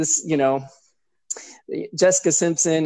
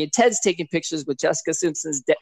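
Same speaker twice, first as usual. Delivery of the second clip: ordinary delivery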